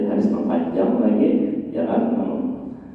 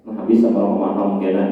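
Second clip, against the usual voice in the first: ordinary talk, nothing marked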